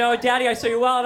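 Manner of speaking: put-on high, loud, quick child voice; insistent daughter. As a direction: imitate a girl blurting it out to Daddy. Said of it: "she said".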